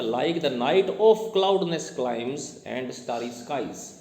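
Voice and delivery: very slow, drawn-out verse reading; reading on through enjambment. Shown as a demonstration. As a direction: carry the verse on slowly to its end. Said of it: "आगे और पढ़ना पड़ेगा".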